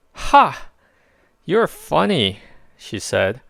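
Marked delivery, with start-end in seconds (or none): 0.1-2.4 put-on higher, louder girl laughing at him; a bright amused young girl; girl finds the big warrior funny; Katz voice, amused. A narrator's reading.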